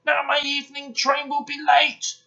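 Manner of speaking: loud, high-pitched outrage; furious. A narrator's reading. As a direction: rage about the delay at full voice. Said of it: "he was furious".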